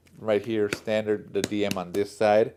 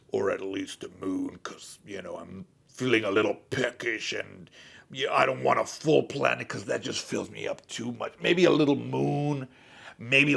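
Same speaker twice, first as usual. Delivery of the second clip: booming warrior king. Retold or imitated. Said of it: "Galactus would have more of a regal voice".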